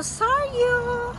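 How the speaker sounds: Saryu! sung out high and bright; Saryu! chimed high, announcer-style; name called out high. Shown as a demonstration. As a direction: call out Saryu! up high like a jingle. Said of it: "si Saryu".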